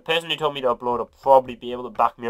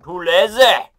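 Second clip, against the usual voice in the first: louder and higher, proud; loud declaration; 7 semitones higher, 10 dB louder, slower than usual. Shown as a demonstration. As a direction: shout the confession out. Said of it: "Which is actually true".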